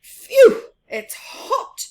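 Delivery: out of breath in the heat; puffing. Acted. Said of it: "he puffed".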